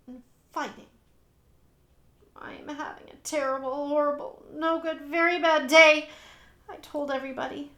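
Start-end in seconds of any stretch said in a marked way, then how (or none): 2.3-6.1 building complaint. Acted. Child announcing to everybody.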